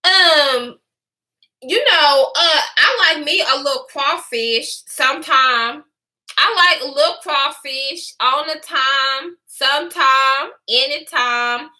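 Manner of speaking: imitating a drawled-out Louisiana accent; mock a forced Louisiana twang, stretching the last words; exaggerated Louisiana drawl impression. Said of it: "this is the way she talks".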